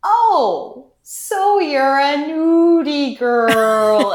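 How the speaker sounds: high, loud, drawn-out grandma impersonation; slow, exaggerated grandmotherly exclamation; grandma's specific voice. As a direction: stretch it out high, expression all in the pronunciation. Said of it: "she has this very specific voice".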